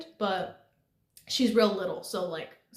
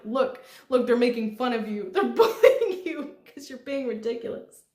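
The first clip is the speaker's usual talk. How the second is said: laughing scolding; 5 dB louder than usual. Retold or imitated. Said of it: "I was like".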